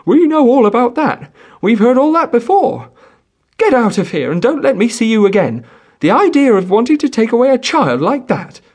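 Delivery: high, loud, angry peasant woman; exasperated. A narrator's reading. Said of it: "The peasant woman, however, exasperated, cut him short".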